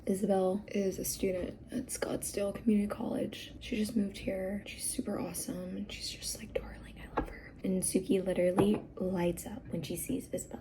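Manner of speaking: soft whisper so the nanny won't hear; hushed whisper. Real, not acted. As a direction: whisper it behind her back, fondly. Nanny nearby, speaking hushed.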